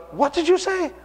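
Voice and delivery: high-pitched startled question; exactly imitated surprise. Patient answers the pastor's unexpected compliment, high surprised question. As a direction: ask it high, as if taken aback. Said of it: "That's exactly how he said it".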